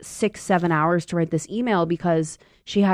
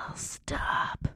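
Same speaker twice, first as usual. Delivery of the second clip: whispered ugh, stop; whispered disgust; 11 dB quieter than usual. Retold or imitated. Germaphobe sitter telling kid to stop, whispered. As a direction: hiss it under your breath. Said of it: "I'm like".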